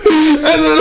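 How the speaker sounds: high, squealing real laughter; helpless laughter. Real, not acted. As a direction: crack up completely and can't go on. Bursts out laughing mid-story.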